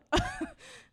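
quick high burst of laughter; laughing at everyone's surprise. Real, not acted. Laugh after friends' reaction.

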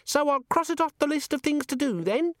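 a tiny gadget imp reciting a memo; giggly sneering imp. A narrator's reading. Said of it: "said Vimes".

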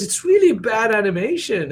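blunt daughter. Retold or imitated. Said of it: "He goes".

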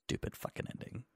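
whispered gripe. Real, not acted. Cohost judging the movie's ending, soft whispered grumble. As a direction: mutter it under your breath.

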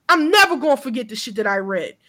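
normal talking voice

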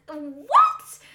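loud, high baffled "what"; half-laughing, retold outburst; incredulous. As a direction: burst out in mock disbelief. Acting baffled reply to dad.

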